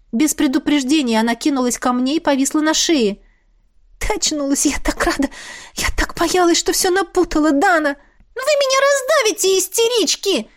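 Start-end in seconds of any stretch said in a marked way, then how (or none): 4.0-8.0 high, tearful joy; overjoyed demoness. A narrator's reading. 8.4-10.5 very high, screeching mop voice; shrieking mop. A narrator's reading. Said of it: "истошно завопила швабра".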